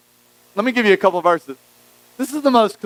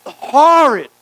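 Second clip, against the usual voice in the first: emphatic horrid; 7 semitones higher, 5 dB louder than usual. Real, not acted. Emphatic horror description.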